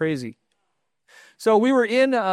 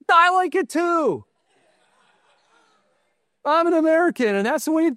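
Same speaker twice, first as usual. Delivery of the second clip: exuberant agreement; 12 semitones higher than usual. Retold or imitated. Exuberant reply to airport clerk.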